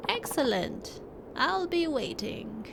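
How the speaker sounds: put-on slow, lightly aged old man; a wise old hermit, unhurried. Reads old hermit NPC lines.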